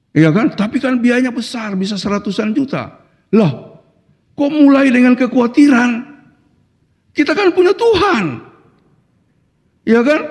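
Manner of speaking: high-pitched, incredulous challenge; impassioned preaching. Real, not acted. Preacher rebuking worry about money for the event, impassioned preaching. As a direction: push back on the doubt, pitch climbing high. Preacher acts out council debate.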